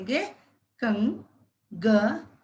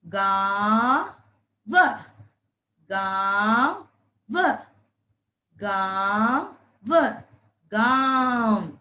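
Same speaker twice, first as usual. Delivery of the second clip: slow sounding out; much slower than usual. Shown as a demonstration. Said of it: "तो यह बन गया गा".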